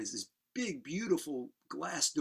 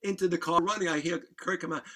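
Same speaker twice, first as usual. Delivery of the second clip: ordinary delivery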